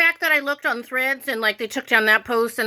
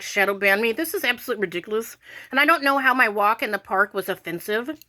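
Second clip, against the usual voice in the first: normal talking voice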